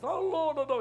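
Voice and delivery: high, loud groaning protest; mock reluctant whine. Pastor told to sing by God, whining reluctance. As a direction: wail it like a put-upon child.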